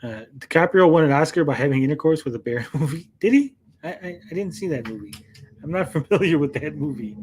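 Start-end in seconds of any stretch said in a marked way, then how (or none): 3.2-3.5 mock surprise. Mock surprise at chat's joke.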